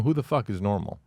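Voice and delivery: normal talking voice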